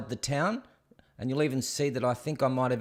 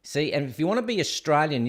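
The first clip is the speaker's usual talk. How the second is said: ordinary talk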